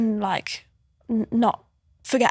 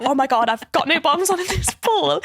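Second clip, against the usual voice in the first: laughing mock panic, pitched up; amused, raised-pitch panic; retold panic; 4 semitones higher than usual. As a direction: replay the panicked thought through laughter. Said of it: "like, panicking".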